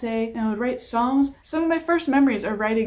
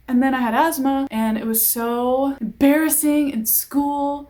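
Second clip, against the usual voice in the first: ordinary delivery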